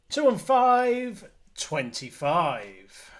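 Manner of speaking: loud, high bingo number call; bingo caller. Acted. Bingo calling on stream.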